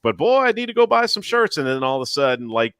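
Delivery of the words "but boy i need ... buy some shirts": high, whiny, put-on beggar voice; sky-high mock voice, hinting for handouts. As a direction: whine for free shirts like a shameless e-beggar. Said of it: "I've seen some other ones out here going".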